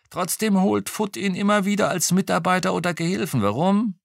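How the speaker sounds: a hint of a gossiping retired policeman; old policeman voice. A narrator's reading. Retired cop chatting to the detective over lunch, lightly voiced.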